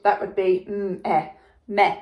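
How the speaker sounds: "meh" sounded out as mm, eh, meh; sounding out wrongly. Shown as a demonstration. Teacher to pupils, showing the wrong sounding "meh". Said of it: "if we use the sound to read it".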